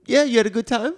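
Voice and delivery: high, loud hammed-up questioning; mock Trump interviewer. S1 voicing Trump pressing Melania, high and loud. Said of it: "She's like".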